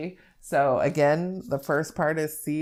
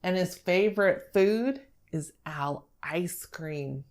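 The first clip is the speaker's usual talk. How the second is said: normal talking voice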